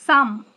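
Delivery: "সাম" hit hard and loud; loud stressed "সাম". Shown as a demonstration. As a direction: punch the syllable "সাম". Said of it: "সামে তুলনামূলক stress বেশি".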